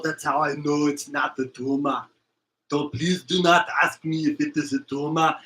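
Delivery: put-on lightly done Austrian accent, "Tuma" with a rolled r; mild Austrian action hero denying a tumor; Arnold Schwarzenegger. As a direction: Austrian movie star voice, "It's not the Tuma" insisted. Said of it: "another one I forgot".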